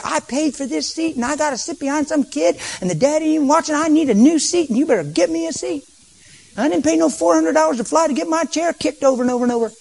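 high, indignant griping about the seat; mock an entitled flyer demanding a new seat; complaining passenger. Angry passenger complains to the crew, high and indignant. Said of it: "some people would say".

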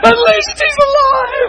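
very high, slow, loud mock cry; wailing kid. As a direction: wail it like a punished child running. Acts out the kid again.

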